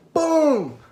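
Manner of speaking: loud high shouted boom; explosive shouted impact; punch sound. Retold or imitated. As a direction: yell it as the blow lands. Imitates a punch landing.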